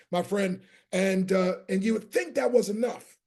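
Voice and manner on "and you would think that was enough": ordinary talk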